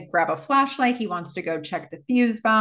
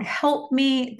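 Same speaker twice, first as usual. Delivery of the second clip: higher-pitched mock cry for help; half-acted plea, pitched up; 6 semitones higher than usual. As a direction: imitate a victim calling out, mildly. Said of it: "She's crying out".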